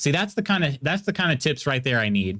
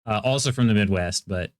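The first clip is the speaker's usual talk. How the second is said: ordinary talk, nothing marked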